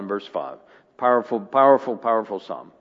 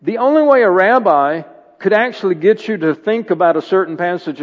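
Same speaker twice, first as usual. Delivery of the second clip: higher, louder preaching, building; full preaching; 6 semitones higher, 5 dB louder than usual. Acted. Pastor explaining rabbis to the church, full preaching. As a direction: lean into it like a revival preacher.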